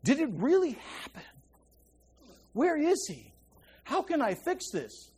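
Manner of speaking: soft, hushed lament with long pauses; Mary grieving quietly by the tomb. Retold or imitated. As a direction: ache quietly over the empty tomb. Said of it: "She's going".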